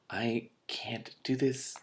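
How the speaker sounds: slow whispered despair; slow hushed despair; overwhelmed whisper. Real, not acted. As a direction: breathe it out, overwhelmed. Reader overwhelmed.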